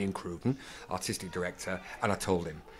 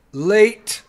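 high, loud one-word heckle; imitating a shouted interruption; 12 semitones higher, 15 dB louder than usual. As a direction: call it out sharply across a theatre. Heckle cutting off his entrance.

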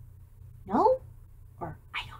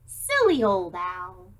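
plain reading voice

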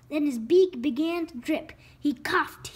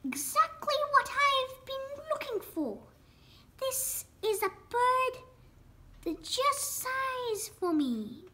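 put-on little old lady; 7 semitones higher, 4 dB quieter than usual. A narrator's reading. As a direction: draw it out in high voice. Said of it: "she exclaimed".

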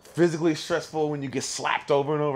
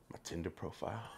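very soft, low admission; small guilty voice, acted out; sheepish confession; 6 semitones lower, 18 dB quieter than usual. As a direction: mumble it like you've been caught. Retold sheepish confession when caught.

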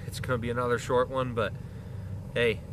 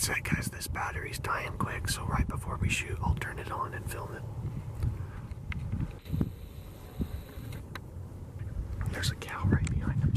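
whispering; 7 dB quieter than usual. Real, not acted. Quiet hunt near the animals.

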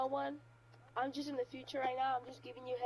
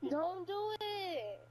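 eerie slow plea; 6 semitones higher, much slower than usual. Acted. Prank caller's eerie warning.

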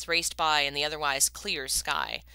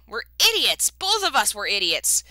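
loud anguished wail, higher; 5 semitones higher, 7 dB louder than usual. A narrator's reading. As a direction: wail it out in self-reproach. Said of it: "Ryuji was wailing".

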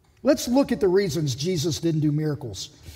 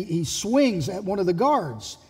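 ordinary talk, nothing marked

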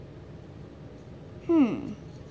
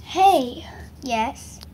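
ordinary talk

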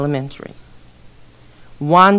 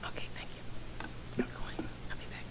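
whisper; 21 dB quieter than usual. Real, not acted. Off-mic aside during silence.

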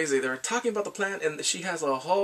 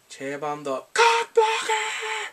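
high, drawn-out heckle; hammed-up exasperated yell; mock outraged cry; 4 dB louder, much slower than usual. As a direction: wail the nickname like a denouncement. Shouts out the intruder's nickname.

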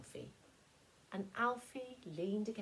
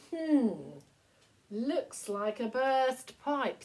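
a touch higher, slow and thoughtful; lightly voiced girl Maureen; 5 semitones higher, 8 dB louder, much slower than usual. Girl noticing the leak, mildly thoughtful. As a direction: Maureen muses over the puddle, slow and wondering.

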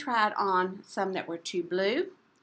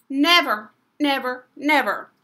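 louder, firm refusal; girl refusing to take socks off; insistent never; 3 semitones higher, 9 dB louder than usual. Acted. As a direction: stamp each never.

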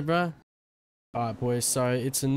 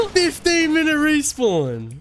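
exasperated; 12 semitones higher, 7 dB louder, slower than usual. Real, not acted. Exasperated after dying.

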